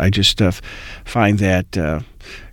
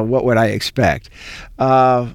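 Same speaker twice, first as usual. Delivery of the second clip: ordinary delivery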